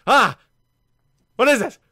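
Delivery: high, loud jump-scare yelp; startled yelp. Real, not acted. Player spooked by a jump scare, loud startled yelp. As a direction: jolt and cry out. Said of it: "I'm scared".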